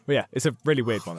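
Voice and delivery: ordinary delivery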